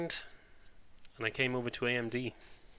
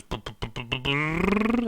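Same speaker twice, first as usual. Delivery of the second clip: mock machine whirring; 5 semitones higher, 6 dB louder, slower than usual. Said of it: "it goes".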